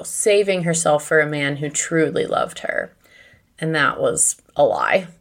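normal talking voice

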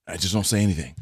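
ordinary talk, nothing marked

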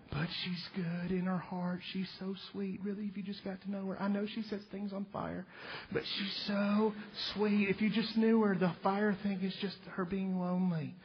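doting mom. Retold or imitated. A mother soothingly defends her daughter to the court. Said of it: "your mom's going around going".

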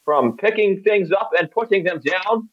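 high, slow, hammed-up dock worker; put-on dock worker voice. Dock worker bragging about lifting things, silly put-on voice. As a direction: boast in a silly, drawn-out high voice. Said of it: "What is that fucking voice?".

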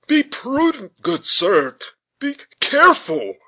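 high-pitched urgent caution; alarmed clergyman begging for care; worried clergyman. A narrator's reading. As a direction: plead anxiously as a fretful parson. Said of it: "said the clergyman".

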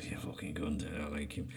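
under-the-breath whisper; whispered insult. Real, not acted. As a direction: hiss the insult to yourself. Muttered insult about Ronaldo.

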